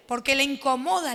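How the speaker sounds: ordinary talk, nothing marked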